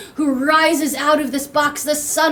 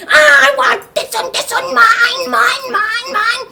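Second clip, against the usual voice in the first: high, loud tantrum wail; screaming child; 11 semitones higher, 6 dB louder than usual. Acted. Boy screaming for the next box, high loud tantrum. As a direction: shriek possessively like a small child. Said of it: "he's screaming for the next box".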